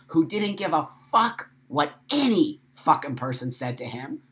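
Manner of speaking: normal talking voice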